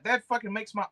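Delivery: ordinary talk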